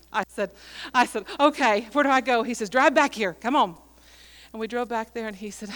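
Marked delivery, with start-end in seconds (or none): 1.4-2.4 loud, ready-to-go question; retold eager reply. Preacher agrees to the old man's plan, loud and eager. 2.7-3.8 loud, eager beckoning; retold urging call. Old man directs the preacher to the barn, loudly urging.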